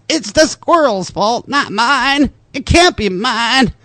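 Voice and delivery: lightly hammed Southern accent; Southern accent, insisting it was the squirrels. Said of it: "I do a pretty good Southern voice of this too".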